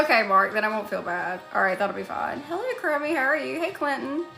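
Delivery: ordinary delivery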